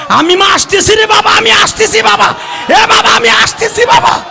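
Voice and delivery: very high, wailing call; acted desperate cry. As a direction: cry out shrilly that help is coming. Acted cry across the river. (আর্তনাদ করে চিৎকারের অভিনয়)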